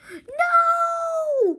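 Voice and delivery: high, loud shout; a panicked cry of no. A narrator's reading. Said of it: "cried George".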